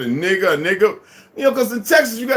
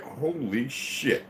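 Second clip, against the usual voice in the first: stunned aside; 5 semitones lower, 11 dB quieter, much slower than usual. Retold or imitated. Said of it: "My brother, I'm like".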